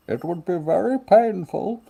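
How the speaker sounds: high, theatrical mock menace; hammed-up villain impression. Carrying on the bit, high mock villain voice. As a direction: threaten in a silly villain voice. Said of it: "the confidence that you deliver them with".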